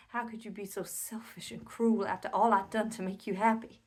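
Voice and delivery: loud, anguished accusation; angry outburst. Acted. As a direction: let the fury burst out at full volume. Furious accusation of husband in monologue.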